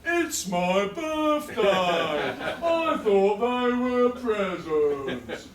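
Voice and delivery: hammed-up dismay at a dull gift; mock innocent disappointment. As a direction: play the hurt birthday child, drawn out. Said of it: "So I stand there and I go".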